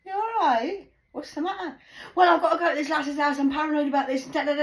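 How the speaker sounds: high, loud, hyper singsong; manic young guy. Retold or imitated. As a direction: bounce around like someone high. Imitating hyper young man.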